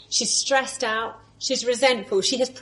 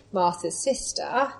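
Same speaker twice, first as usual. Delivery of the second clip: ordinary delivery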